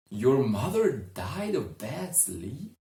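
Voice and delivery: can't hide the glee; the woman perks up, thrilled at the news; barely hidden delight. Acted. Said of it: "could not hide her enthusiasm".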